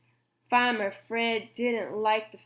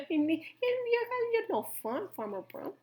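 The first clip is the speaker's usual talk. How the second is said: very high, slow, soft squeak; a tiny critter complaining; squeaky animal voice; 10 semitones higher, 7 dB quieter, slower than usual. A narrator's reading.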